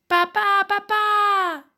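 put-on very high, loud joyful children's cry; esclamazione dei bambini. A narrator's reading. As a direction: shout for dad like excited kids. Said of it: "esclamano i bambini". (grido acutissimo di bambini felici)

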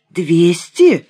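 startled, incredulous question; unable to hold back. A narrator's reading. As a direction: blurt it out in disbelief. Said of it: "не удержался я". (не удержавшись, удивлённо)

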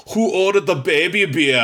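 German waiter voice. Retold or imitated. Waiter teasing the brother over his small beer, light German accent. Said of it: "And he goes".

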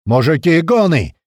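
loud, high-pitched warning shout; explosive alarm. A narrator's reading. Scout warning comrades over the radio, explosive alarm. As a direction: burst out with the alarm. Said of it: "Внезапно взорвалась рация голосом Крота".

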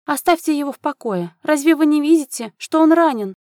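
high, protesting mother's voice; frantic countess defending her son; indignant intervention. A narrator's reading. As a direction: rise to a loud, anxious scolding. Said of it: "Вновь вмешалась графиня".

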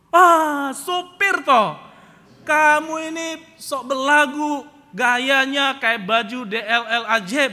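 slow, very loud, high-pitched scorn; Pak Mukidi. Retold or imitated. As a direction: sneer at the driver at full volume, dragging it out. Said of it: "Pak Mukidi marah-marah". (mengejek marah, lantang dan dipanjang-panjangkan)

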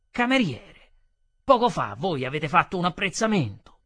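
loud, threatening accusation; menacing. Acted. Offended customer accusing the waiter of a remark, menacing. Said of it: "disse minaccioso".

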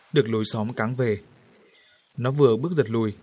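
usual speaking voice

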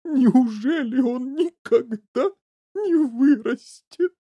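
slow, high, loud wail; sobbing. A narrator's reading. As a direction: ask it through hammed-up tears. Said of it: "снова разрыдалась миссис Ти Ви".

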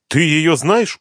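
taken aback, voice lifting high; surprised. A narrator's reading. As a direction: ask in open astonishment. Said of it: "удивилась Суслова".

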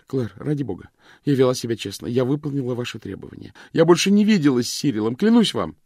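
pleading wife rising in panic; desperate pleading. Acted. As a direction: beg her, voice climbing to a near cry. Vivien pleads desperately with Claire.

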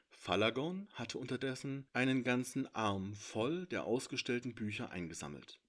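ordinary delivery

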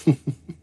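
quiet little ghostly laugh; soft laugh. A narrator's reading. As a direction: laugh softly. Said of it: "Она тихонько рассмеялась".

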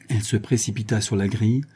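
ordinary delivery, nothing marked

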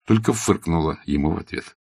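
plain reading voice

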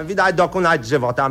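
ordinary delivery

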